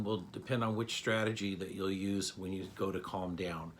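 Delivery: normal talking voice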